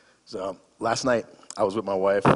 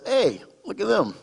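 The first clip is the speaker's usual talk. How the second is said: cheerful call; 13 semitones higher, 5 dB louder than usual. Retold or imitated. Calling ex-wife's attention in mall.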